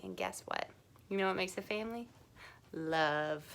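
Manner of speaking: hushed, tender answer; soft reveal. Real, not acted. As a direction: draw them in quietly, then say love gently.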